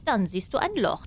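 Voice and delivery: plain reading voice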